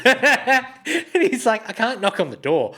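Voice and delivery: laughing through the quote, high; giggling hard while telling it. Real, not acted. Telling a mate about the pilot, laughing.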